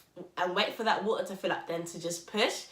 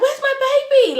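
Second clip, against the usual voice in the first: very high, loud, laughing alarm; excited cry; 13 dB louder than usual. Retold or imitated. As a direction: burst out with a high, laughing shout. Thinks baby came out.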